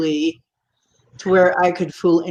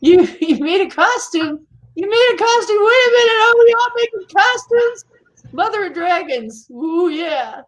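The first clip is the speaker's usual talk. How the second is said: excited; 12 semitones higher, 4 dB louder than usual. Real, not acted. Delighted at a viewer's costume.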